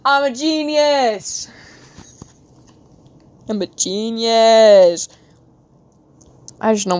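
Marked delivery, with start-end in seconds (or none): 0.1-1.5 slow drawn-out self-praise; proud exclaim. Real, not acted. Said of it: "I just got an idea". 3.5-5.1 slow, loud triumphant cry; louder proud shout. Real, not acted. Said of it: "I just got an idea".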